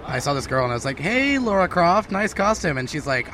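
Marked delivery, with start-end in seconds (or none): 1.0-2.8 flirty greeting. Retold or imitated.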